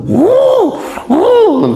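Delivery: mock witch huff. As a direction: puff it out like Mak Lampir raging. Imitating Mak Lampir's fury. (dengusan nenek sihir yang pura-pura)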